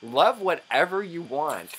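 ordinary delivery

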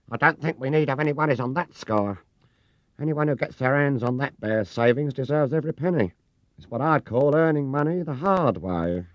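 a dry, knowing housekeeper teasing about the bear; Missus Bird's voice. Acted. Narrator voices Missus Bird.

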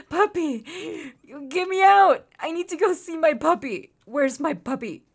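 high, loud eager outburst; clamor for it, barely able to wait; impatient excitement. Real, not acted. Player leaving to find puppy, excited and impatient. Desperate to reach new puppy.

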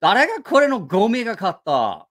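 怒って問い詰める; indignant Japanese. Retold or imitated. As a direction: demand who bought this trash. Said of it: "He's like".

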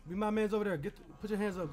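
normal talking voice

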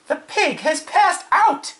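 high, slow, loud alarm; alarmed announcement. Acted. Farm wife seeing the pig faint, high alarm.

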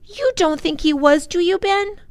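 put-on high-pitched child appealing; high, hopeful child's question; pleading little girl. A narrator's reading. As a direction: appeal to your brother in a small high voice. Lindy appeals to her brother.

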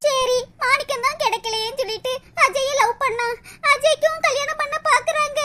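soft, tearful lament; acted crying. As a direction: sob quietly through a self-pitying complaint. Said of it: "மூசு மூசுன்னு அழுதுகிட்டு கிடக்கு". (அழுதுகொண்டே மெல்லப் புலம்பல்)

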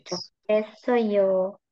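Chokesayo repeated very slowly with a clear hard k; slow repeated model word. Shown as a demonstration. (Chokesayo diulang sangat pelan, k jelas)

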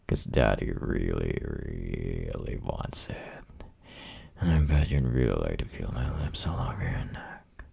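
put-on breathy close-mic whisper to a girlfriend; teasing boyfriend whisper, close and intimate. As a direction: whisper it right into her ear.

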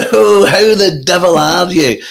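drawn-out, high comedian impression; Billy Connolly. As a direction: exuberant phone greeting in an impersonated comic's voice. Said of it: "I'm Billy Connolly".

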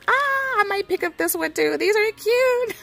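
high, fast, delighted squeal; excited squeal. Real, not acted. Crafter spotting cute bird stamps in the store, squealing with delight. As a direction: gush over the cute stamps.

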